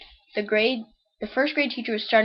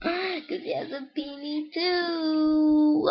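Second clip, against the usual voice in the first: drawn-out whine; 6 semitones higher, much slower than usual. Real, not acted. Reaction to the joke, drawn out.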